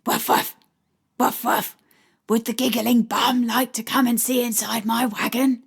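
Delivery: playful put-on dog voice with barks; woman voicing a china dog for a shy child; doggy voice. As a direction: play a friendly dog to coax a shy boy. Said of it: "my best version of a doggy voice".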